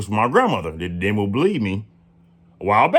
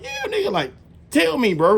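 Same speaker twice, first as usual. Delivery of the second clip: much higher, pushing her to spill it; impatient urging. Retold or imitated. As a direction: hurry her up, eager and impatient.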